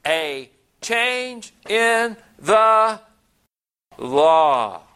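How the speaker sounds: "change in the law" extremely slow, each word set apart, "law" loud; emphatic word-by-word. Acted. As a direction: hammer out "change in the law", drawing out every word. Preacher hammers key words of verse.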